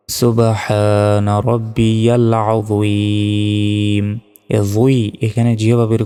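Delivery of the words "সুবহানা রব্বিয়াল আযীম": very slow, chanted tasbih; slow Quranic recitation. Shown as a demonstration. Teacher to worshippers, slow chanted recitation. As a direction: recite it slowly with held vowels. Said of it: "আমি যেভাবে পড়াচ্ছি, এভাবে আপনারা".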